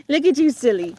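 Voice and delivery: bright sing-song at a silly pup; playful dog talk. Real, not acted.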